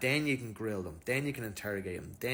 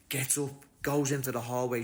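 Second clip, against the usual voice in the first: ordinary delivery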